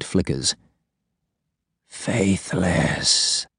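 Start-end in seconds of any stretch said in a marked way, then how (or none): 1.9-3.5 cold, quiet, inhuman hiss. A narrator's reading. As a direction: speak like something not human. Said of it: "little humanity in the prisoner's voice".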